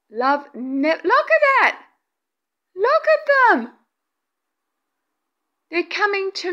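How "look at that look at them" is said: high, sudden amazed outburst; excited. Real, not acted. Medium pointing out orbs to viewers, excited. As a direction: break off and marvel aloud.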